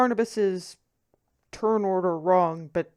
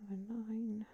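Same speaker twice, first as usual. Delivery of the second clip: whispering; 18 dB quieter than usual. Real, not acted. GM counting under his breath.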